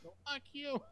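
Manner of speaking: very high mock kid retort; defiant son impression. Son answering rude adult, high defiant impression.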